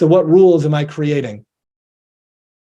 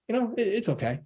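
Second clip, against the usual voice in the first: much softer, higher, half-hearted "it's okay"; mock unenthused answer; 6 semitones higher, 12 dB quieter than usual. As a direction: play someone shrugging off their day, barely there. Imitating someone's meh answer.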